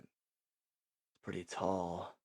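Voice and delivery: plotting whisper. Retold or imitated. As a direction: whisper it so no one overhears. Plotting escape under his breath.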